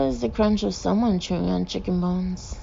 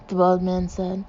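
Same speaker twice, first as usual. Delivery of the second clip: plain reading voice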